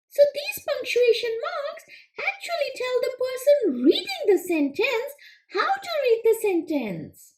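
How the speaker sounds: high, quick little frog puppet, eager to answer; squeaky-high puppet frog pleased with itself; Froggy puppet voice. Acted. Frog puppet answering the teacher, high and eager.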